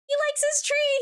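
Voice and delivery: thrilled squeal over the cat; delighted squeal. Real, not acted. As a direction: squeal with joy.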